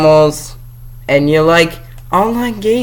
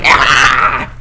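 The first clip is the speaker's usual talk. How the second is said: mock scream; 2 semitones lower than usual. Triggered by Barbie.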